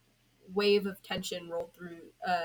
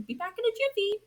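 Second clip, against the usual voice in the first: high, loud, fast call; shouted; 7 dB louder, faster than usual. A narrator's reading. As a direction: shout it over your shoulder. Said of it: "Danny shouted".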